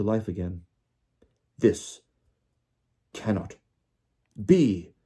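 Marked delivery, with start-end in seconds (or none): 1.6-4.9 halting, word-by-word delivery; jerkily. A narrator's reading. Stunned official staring at his elf, jerkily. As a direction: break it up with long pauses. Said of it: "he said jerkily".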